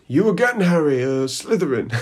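lightly hammed-up hat voice, starting high and loud; mock proclamation dissolving into a laugh; Sorting Hat. Sorting Harry again, lightly hammed-up. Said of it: "the sorting hat goes".

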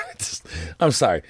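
laughter cuts into the word "it's"; high laugh breaking through. Real, not acted. Laughs at own joke.

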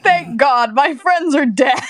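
loud, hammed-up thankful cry; mock relieved quote. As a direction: overdo the relief, loudly. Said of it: "he was like".

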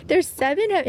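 put-on dad's Indian accent, cut short; a light Indian-accented dad. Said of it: "in his Indian accent".

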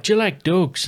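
put-on high, bouncy Irish accent; Irish lilt, playful. Host keeps up the Conor McGregor Irish accent. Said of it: "it was a bit like".

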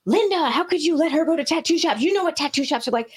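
high-pitched mock outrage of a dad; dad losing it. Dad scolds Linda over the tattoo shop, imitated high-pitched outrage. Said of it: "my dad lost his shit".

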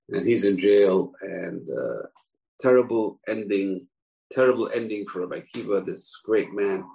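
ordinary talk, nothing marked

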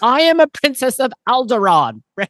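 high, proud, imitated young princess; imitating the captive princess's high, proud defiance; mock defiant princess. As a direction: play a haughty little princess threatening her captors. Retelling young princess defying captor.

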